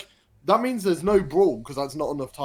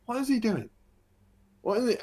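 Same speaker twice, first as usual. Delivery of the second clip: puzzled crowd; 10 semitones higher, faster than usual. Retold or imitated. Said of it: "and us all going".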